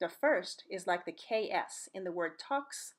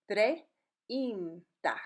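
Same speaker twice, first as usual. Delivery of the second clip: "treinta" broken slowly into treh, een, tah; slow teaching demo of "treinta"; slowed down, syllable by syllable. Shown as a demonstration. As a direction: draw out "treinta" in separate vowel sounds. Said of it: "Don't say it slow and weird".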